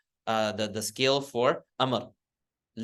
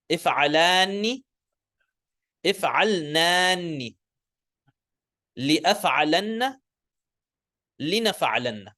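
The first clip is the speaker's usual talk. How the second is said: say-after-me drill; 4 semitones higher, 3 dB louder than usual. Shown as a demonstration. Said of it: "say it after me".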